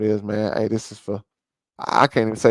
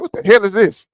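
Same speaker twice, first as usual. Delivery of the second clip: higher and louder, fed-up disbelief; exasperated shout; 7 semitones higher, 6 dB louder than usual. Real, not acted. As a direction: throw the question at them in frustration. Ranting at the audience.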